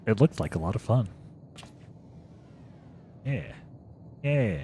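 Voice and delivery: ordinary delivery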